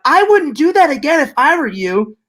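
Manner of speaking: a hint of a menacing talking doll; creepy doll voice. Doll warns the husband in a mildly creepy voice.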